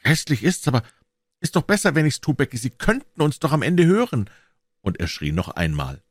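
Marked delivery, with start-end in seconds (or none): 0.0-4.3 lightly voiced boy, a touch higher; a brave boy thinking aloud; hint of a worried boy; boy's voice. A narrator's reading. Narrator voices Tom.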